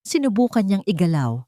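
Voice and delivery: ordinary talk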